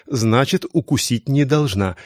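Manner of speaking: plain reading voice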